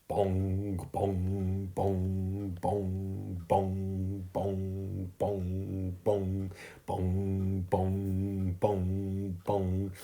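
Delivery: sustained, even bongs on one note; imitated clock chimes. A narrator's reading. Grandfather clock striking midnight, voiced chimes. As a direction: intone each stroke like a great clock. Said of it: "chimed midnight".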